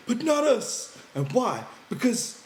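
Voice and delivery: high, loud excited burst; eager interruption. A narrator's reading. Lenny breaking into George's story, high and excited. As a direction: jump in with childlike glee. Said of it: "Then he broke in".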